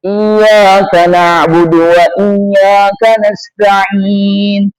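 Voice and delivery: "Iyyaka na'budu wa iyyaka nasta'in" chanted slowly with each y held double; doubled y recitation. Shown as a demonstration. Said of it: "harus diberikan tasydid pada huruf ianya".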